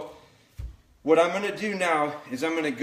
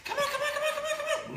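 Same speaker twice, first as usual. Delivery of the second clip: much higher, sing-song come here; high puppy call; faster than usual. Real, not acted. Trainer coaxing the puppy over, high sing-song call. Calling distracted puppy from stove.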